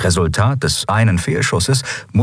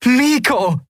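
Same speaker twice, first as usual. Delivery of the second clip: high, joyful cry; elated high call; jubilant. A narrator's reading. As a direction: cheer the name out, pitched high. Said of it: "jubelte Endriel".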